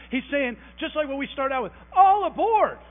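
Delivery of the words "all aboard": loud, high call to board the train; crying out. Retold or imitated. Paul crying out to the church, loud and high. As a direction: call everyone aboard at full voice. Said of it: "Paul is crying out".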